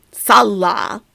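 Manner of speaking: Salah said loudly as SAL-lah, first syllable stressed; mocking SAL-lah for Salah; misplaced stress. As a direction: imitate a learner hitting the SAL in Salah. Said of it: "emphasis is always on the wrong syllable".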